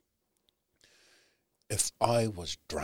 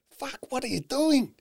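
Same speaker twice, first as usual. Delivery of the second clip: much higher mock yell at himself; self-scolding yell; 4 dB louder than usual. Man at the cliff catching his own thoughts, a high mock yell. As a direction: yell it at yourself, exasperated.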